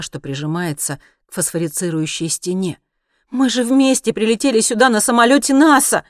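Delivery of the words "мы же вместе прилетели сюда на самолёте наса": high, loud despairing plea; desperate exclamation. A narrator's reading. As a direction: cry out in desperation to be believed. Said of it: "в отчаянии воскликнула она". (в отчаянии)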